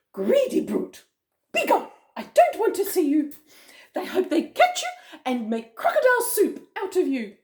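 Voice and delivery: high, loud storybook telling-off; indignant scolding. Acted. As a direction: scold the villain in acted outrage. Humpy Rumpy scolds the crocodile.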